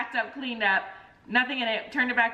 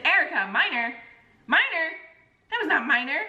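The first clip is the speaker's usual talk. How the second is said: incredulous protest; 6 semitones higher than usual. Retold or imitated. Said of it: "And I said".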